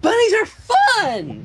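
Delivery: high, slow shrieking squeal; squealing Pinkie. Acted. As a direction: squeal it out, bouncing. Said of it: "squealed Pinkie Pie".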